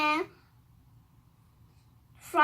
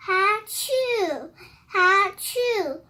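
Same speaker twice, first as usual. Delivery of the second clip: pretend sneeze; 3 semitones higher, 3 dB louder, much slower than usual. Storyteller acts out a monkey's sneeze, pretend achoo. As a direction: act out two spoken sneezes.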